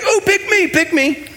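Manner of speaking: high, eager schoolkid begging to be picked; like a kid waving his hand in class. Retold or imitated. Peter begging Jesus to pick him, high and eager. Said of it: "Peter, he's like".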